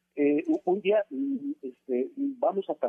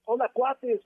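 high Chabelo voice; 8 semitones higher, slower than usual. Retold or imitated. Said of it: "súper agudo".

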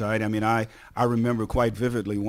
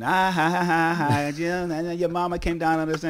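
imitated jeering ha-ha-ha; mock teammate laughter; 5 semitones higher than usual. Teammates tease a player whose mama came down, mock laughter. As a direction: fake a teasing laugh, pitched up. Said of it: "teammates started laughing".

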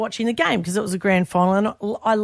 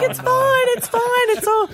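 flustered reassurance. Retold or imitated. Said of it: "I was like".

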